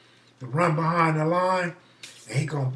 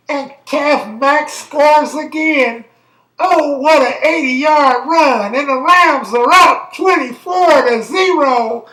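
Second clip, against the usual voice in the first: higher, louder, slow hammed-up play-by-play call; sportscaster voice; 11 semitones higher, 14 dB louder, slower than usual. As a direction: belt it out like a TV football announcer. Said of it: "acting like you was Howard Cosell".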